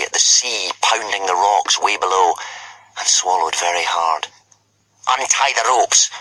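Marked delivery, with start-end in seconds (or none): 5.1-6.1 ordering. A narrator's reading. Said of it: "ordered Snotlout".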